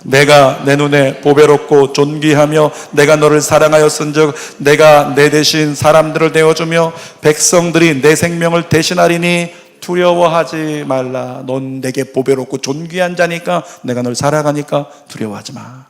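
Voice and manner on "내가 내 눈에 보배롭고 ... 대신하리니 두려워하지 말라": louder, proclaiming recitation; steady unison Bible reading; congregational scripture reading. Real, not acted. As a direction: read it out together, ending slow. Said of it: "시작".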